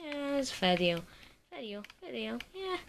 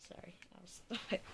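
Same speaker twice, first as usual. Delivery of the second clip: ordinary delivery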